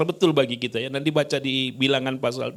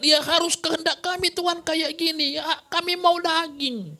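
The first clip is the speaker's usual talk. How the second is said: insistent demand; 14 semitones higher than usual. Retold or imitated. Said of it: "memaksakan kehendak".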